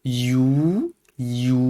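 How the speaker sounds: ordinary delivery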